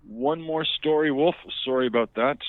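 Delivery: loud, high anchor read; news anchor voice. Acted. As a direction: bark it like a frantic anchor. Mock anchor aside, loud.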